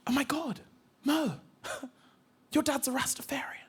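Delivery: high, gasping whisper; awed schoolkid. Retold or imitated. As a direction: kid whispering in amazement. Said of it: "he's like".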